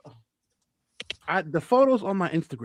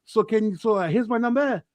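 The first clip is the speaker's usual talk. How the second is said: higher-pitched imitation French-Brazilian accent; 4 semitones higher than usual. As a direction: plead in a put-on, weird French-Brazilian accent. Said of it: "he has, like, a weird French Brazilian accent".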